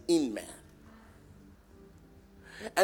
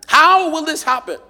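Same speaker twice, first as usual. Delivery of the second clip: loud question; 12 semitones higher, 12 dB louder than usual. Acted.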